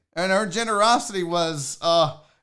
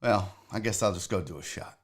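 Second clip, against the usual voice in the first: low, quiet, dopey mumble, lower and softer; imitating the guy; 13 semitones lower, 7 dB quieter than usual. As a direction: mumble it like a clueless guest. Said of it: "he literally just looked at the door and goes".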